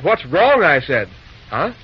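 normal talking voice

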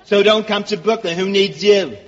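combative Brooklyn wise guy; hammed-up Brooklyn native, talking back; Brooklyn accent. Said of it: "let's get out of Brooklyn".

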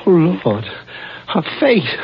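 high, shocked exclamation; horrified. Acted. Man discovering his girlfriend's injuries, horrified. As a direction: recoil in horror at the sight. Finds her injured body.

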